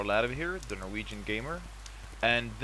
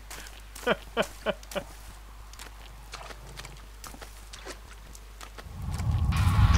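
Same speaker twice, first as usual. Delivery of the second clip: high laugh; 11 semitones higher, 4 dB louder than usual. Real, not acted. Laughing at nasty game scene.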